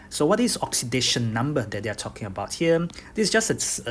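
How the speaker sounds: usual speaking voice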